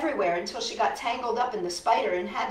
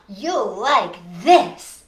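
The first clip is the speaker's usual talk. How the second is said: teasing; 10 dB louder, much slower than usual. A narrator's reading. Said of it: "she said".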